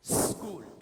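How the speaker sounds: "स्कूल" from a long bare s; correct pronunciation. Shown as a demonstration. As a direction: say "स्कूल" without any leading vowel. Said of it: "ये तरीका है".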